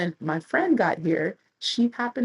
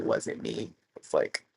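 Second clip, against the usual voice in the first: whispered, slower aside; hushed aside; 5 dB quieter, slower than usual. Real, not acted. Confiding it wasn't her, whispered. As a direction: drop to a whisper.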